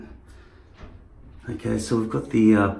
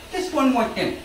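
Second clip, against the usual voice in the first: higher playful voice; mock accent; 14 semitones higher than usual. Shown as a demonstration. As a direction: toss it off in a put-on voice. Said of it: "these accents".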